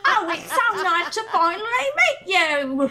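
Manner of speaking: high, hammed-up fake Cockney accent; mock a put-on Cockney greeting. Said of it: "his fake Cockney accent".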